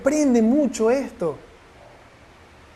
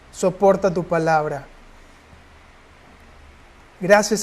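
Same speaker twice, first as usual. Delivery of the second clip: earnest, measured prayer voice; heartfelt prayer. Acted. Preacher leading the congregation in closing prayer, earnest. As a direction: pray it out to the Father, steady and sincere.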